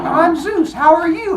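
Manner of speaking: high, loud, chirpy hello; hammed-up eager first introduction; over-cheery greeting. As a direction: greet a long-lost brother far too brightly. Zeus greets brother for first time.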